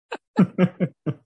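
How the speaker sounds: short real laugh breaking out; real burst of laughter. Real, not acted. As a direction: laugh out loud at the memory. Laughing at son's cussing.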